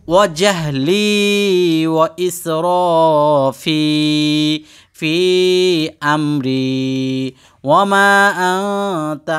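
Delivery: slow chant with every long vowel stretched; drawn-out Quranic recitation. Shown as a demonstration. Teacher shows students how to recite the dua, slow drawn-out chant. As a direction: intone it slowly, holding each madd. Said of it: "দ্বিতীয় নম্বর এই দোয়াটি পাঠ করবেন".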